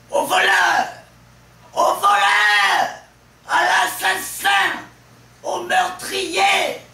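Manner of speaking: high, full-force cries for help; Harpagon's frantic exclamations. Acted. Robbed miser cries thief to the world, frantic screaming. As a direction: scream out each exclamation, stretching the key words. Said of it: "Le monologue débute par une série d'exclamations".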